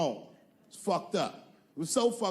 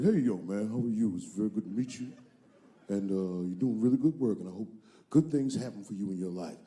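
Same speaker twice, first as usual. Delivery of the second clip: lower, smooth celebrity voice; imitating O J Simpson; 8 semitones lower than usual. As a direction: do a low, friendly O J. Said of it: "He's like".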